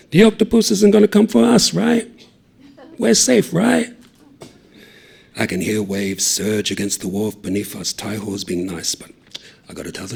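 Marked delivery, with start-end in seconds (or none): 0.1-3.9 nervous hope. Acted. Anxious seeking reassurance.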